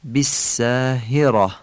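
بالساهرة slowly, with clear Arabic "i" vowels; classical kasras. Shown as a demonstration. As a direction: say both kasras of بالساهرة as "i", not "e". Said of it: "کسره ها رو باید فصیح بگیم".

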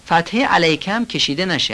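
ordinary talk, nothing marked

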